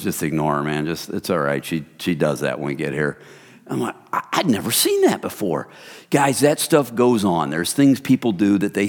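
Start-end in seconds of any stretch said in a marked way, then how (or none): 0.0-3.2 calm reassurance. Retold or imitated. Group member with arm round him, low calm reassurance. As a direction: lower and softer, unbothered.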